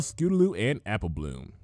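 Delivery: ordinary delivery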